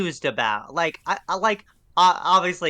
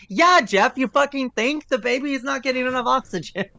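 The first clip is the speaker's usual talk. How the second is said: exasperated outburst; 8 semitones higher than usual. Retold or imitated. Venting at Jeff over the blue baby, exasperated shout. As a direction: blow up at how obvious it is.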